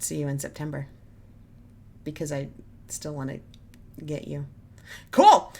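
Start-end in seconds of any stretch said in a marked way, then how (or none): 5.1-5.5 high, loud burst of a word; sudden shout. Real, not acted. Reviewer abruptly wrapping up her video, sudden high shout.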